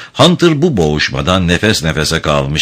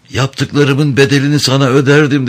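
strained, higher plea in a choked voice; 7 semitones higher than usual. A narrator's reading. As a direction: plead in a muffled, choked voice. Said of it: "boğuk bir ses tonuyla". (boğuk bir ses tonuyla)

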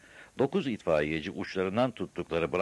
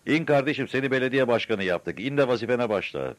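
high, raised-voice hail to a rooftop; calling up loudly; 7 semitones higher, 5 dB louder than usual. A narrator's reading. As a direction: call up through cupped hands. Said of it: "iki elini ağzına boru yapıp yukarı seslendi".

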